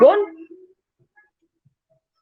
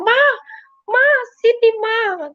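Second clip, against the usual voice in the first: high-pitched retold call for mom; urgent frightened call; 11 semitones higher than usual. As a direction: re-enact calling mother over in alarm. Retelling frightened calls to mother. (menirukan panggilan panik ke mama)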